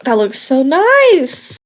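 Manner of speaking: high-pitched, gushing compliment; excited gushing. Acted. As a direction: gush like a friendly shopper, drawing out the end. [name] gushing over an outfit.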